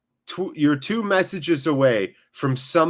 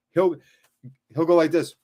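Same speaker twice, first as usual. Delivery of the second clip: ordinary talk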